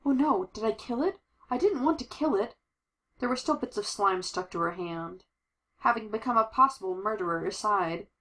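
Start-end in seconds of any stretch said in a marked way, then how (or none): 0.0-2.6 higher-pitched, dismayed outburst; dismay pitched up over an accident. Acted. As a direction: voice a worried "oh no" moment. Dialogue after accidentally killing slime.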